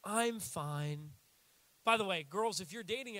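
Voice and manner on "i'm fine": a lightly sulking, lower "I'm fine"; sulky "I'm fine". Retold or imitated. Needy girlfriend pouting to her partner, lightly sulky. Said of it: "a needy girlfriend or boyfriend".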